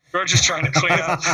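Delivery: real giggle taking over; laughing through words. Real, not acted. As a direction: lose it mid-sentence. Laughing while telling.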